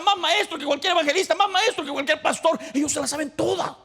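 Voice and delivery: high, loud preacher's cry; impassioned pulpit shout; full-force preaching. Acted. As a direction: preach it hard to the congregation. Preacher mocks know-it-all Christians.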